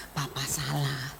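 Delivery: soft, low-key admission of guilt; subdued confession. Retold or imitated. Father confessing his sin to his wife and children, subdued. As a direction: confess it quietly, like a humbled father.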